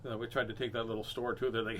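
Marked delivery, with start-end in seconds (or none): none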